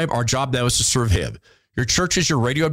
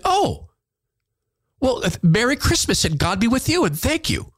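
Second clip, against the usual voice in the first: cheery surprised nurse; 9 semitones higher than usual. Retold or imitated. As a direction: pitch leaps high on a bright oh, then stays up. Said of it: "And she said".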